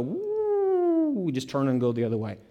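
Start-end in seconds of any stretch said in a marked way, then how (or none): 0.0-1.1 high startled woo; mock startled whoop, pitch jumping way up. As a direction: yelp it out like you're backing away from something. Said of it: "we sort of go".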